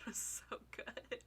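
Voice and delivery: breathy whispered gush. Real, not acted. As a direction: whisper it in awe. Gushing over a performance.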